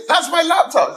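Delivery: very high, outraged protest. Retold or imitated. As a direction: squeak it out in disbelief.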